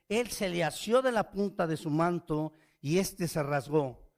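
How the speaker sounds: scripture reading. Acted.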